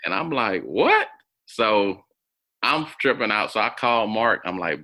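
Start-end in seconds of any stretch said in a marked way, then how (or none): 0.6-1.1 retold high, louder startled "What?"; shocked disbelief. Hearing his mom knows his brother's mother, loud high shocked "What?". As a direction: blurt it out in shock.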